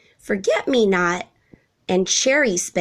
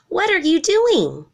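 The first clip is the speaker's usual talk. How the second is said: high, bright-eyed little giraffe voice; eager giraffe child, pitched way up; young giraffe; 11 semitones higher, 3 dB louder than usual. A narrator's reading. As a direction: call down from the treetop as a much higher young giraffe. Young giraffe calls from above.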